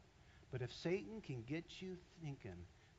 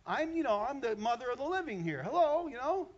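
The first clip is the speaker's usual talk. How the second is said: higher, louder sassy offended woman; indignant Eve; 8 semitones higher, 6 dB louder than usual. Acted. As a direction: huff it out, insulted and sarcastic. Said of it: "she's thinking".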